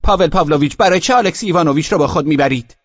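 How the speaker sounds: high, loud, fast scolding; reproachful complaint. Acted. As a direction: girls protesting sharply.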